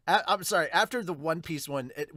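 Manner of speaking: usual speaking voice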